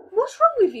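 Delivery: mock outraged. Indignant retort in quarrel.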